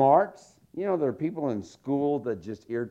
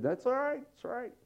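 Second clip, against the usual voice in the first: gentle God voice; 10 semitones higher, 5 dB quieter, faster than usual. Retold or imitated. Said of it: "But God said".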